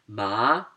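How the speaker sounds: MA gliding upward; second tone. Shown as a demonstration. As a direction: say "ma" with rising pitch, like a question. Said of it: "let me give you an example".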